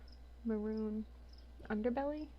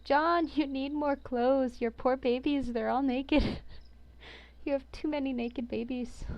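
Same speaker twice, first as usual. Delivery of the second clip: a touch of hammed-up doting scolding; mock scolding; 3 semitones higher, 7 dB louder than usual. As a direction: fuss at them like a fussy mum over underdressed kids. Fussing over naked dragons like babies.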